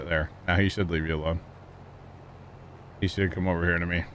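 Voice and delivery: ordinary talk, nothing marked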